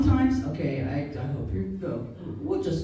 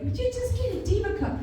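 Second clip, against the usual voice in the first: mock girl voice; 11 semitones higher, faster than usual. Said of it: "a girl was like".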